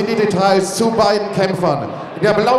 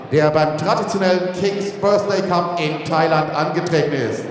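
ordinary talk, nothing marked

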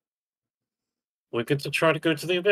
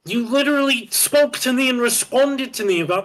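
raised, high-pitched exasperation; angry yelling; 11 semitones higher, 4 dB louder than usual. Real, not acted. Player scolding teammate for not listening, angry yelling. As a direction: scold them, voice pitched right up. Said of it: "yelling at me".

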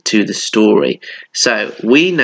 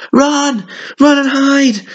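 crying in despair; 14 semitones higher, slower than usual. A narrator's reading. Animals fleeing the woken bear: high panicked cry. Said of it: "they all cried in despair".

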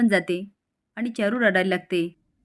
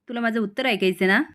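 plain reading voice

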